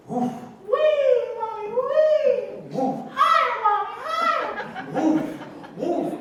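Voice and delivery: very high, loud squeals of joy; gleeful child flying. Acted. Baby eagle riding high on mama's back, squealing with glee. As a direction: squeal like a kid on a ride.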